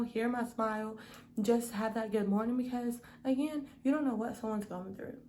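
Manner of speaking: ordinary delivery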